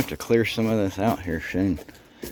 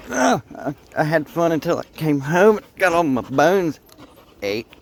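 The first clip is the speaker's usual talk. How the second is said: dog voice; 6 semitones higher, 3 dB louder than usual. Pretend. Voicing the dog's complaint.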